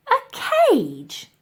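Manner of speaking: high, outraged cry; shocked exclaim. Acted. Elsie hearing of the cage, high shocked cry. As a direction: cry it out in disbelief. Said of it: "exclaimed the girl".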